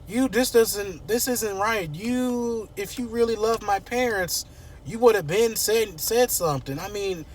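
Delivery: high-pitched, wounded protest; mock distraught reproach, high and halting; upset accusing daughter. Acts Kat accusing Eva.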